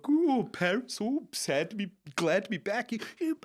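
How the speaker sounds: high, mocking singsong whine. Friend mocking the tour manager's Paris complaint, high mocking whine. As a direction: parrot the complaint back to belittle it. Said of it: "he gave me a big talking to".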